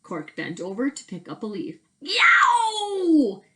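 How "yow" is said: high loud pained yelp; a sudden high shout of pain; yelled yow. Acted. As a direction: cry out as if pricked by a thorn. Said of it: "he yelled".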